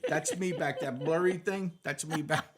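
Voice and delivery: high, loud, laughing mock pride; excited boasting. SWAT cop showing his photo album, proudly excited. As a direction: show off excitedly, hammed up.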